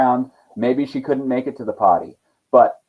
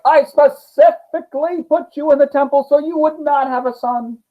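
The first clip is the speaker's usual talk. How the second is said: high, indignant outburst; angry king scolding his daughter; furious king; 12 semitones higher than usual. Acted. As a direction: scold her like a betrayed father. Astyages is furious.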